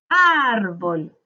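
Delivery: load the voice onto the ÁR of "árbol"; drawn-out ÁR in "árbol", falling away on bol; stressed syllable stretched. Shown as a demonstration. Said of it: "descubrimos la sílaba tónica".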